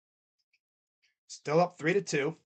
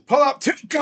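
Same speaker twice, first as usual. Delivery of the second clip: frustrated shout; 8 semitones higher, 5 dB louder than usual. Real, not acted. Rival hits a shot.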